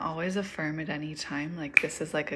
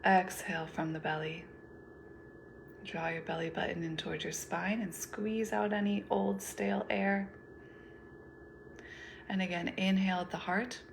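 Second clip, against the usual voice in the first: guided meditation. Acted. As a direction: lead them gently through each breath, unhurried.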